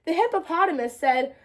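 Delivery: ordinary delivery, nothing marked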